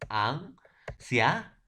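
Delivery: drawn out slowly, syllable by syllable; slow syllable demo. Shown as a demonstration. Teacher showing students how to split the word, slowly drawn out. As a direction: spell out the syllables slowly for the class. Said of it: "cómo voy a silabear la palabra anciano".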